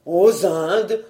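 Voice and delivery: restrained put-on horror; feigned dread. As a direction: echo it as if suddenly appalled. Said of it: "en feignant une subite épouvante".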